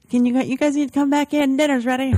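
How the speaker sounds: high, loud mock call to dinner; imitated dad calling. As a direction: holler it like a parent fetching the kids. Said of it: "he's like".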